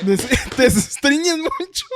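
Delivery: high, slow mock Spanish ad voice; infomercial pitchman. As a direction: draw out the hammy sales question. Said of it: "there's some infomercials trying to sell you something".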